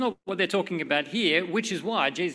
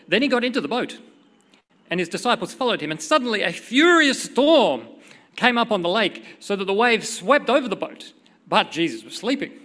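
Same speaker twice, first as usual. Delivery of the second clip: louder, emphatic storytelling; dramatic scripture reading; 3 semitones higher, 6 dB louder than usual. A narrator's reading. Preacher reads the storm story to the church, loud and dramatic. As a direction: read the storm with mounting force, then settle.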